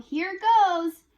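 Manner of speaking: high, loud gleeful yell. Acted. Piggy brother jumping into the puddle, loud yell. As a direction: shout it as you leap in. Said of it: "He held his nose and yelled".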